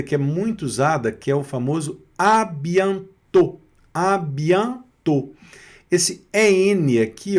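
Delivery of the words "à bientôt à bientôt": slow, higher "à bientôt" with nasal an. Shown as a demonstration. Teacher introduces a famous farewell, "à bientôt" slowly sounded out. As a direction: stretch "à bientôt" syllable by syllable. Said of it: "tem um som de an".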